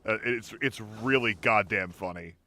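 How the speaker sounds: ordinary talk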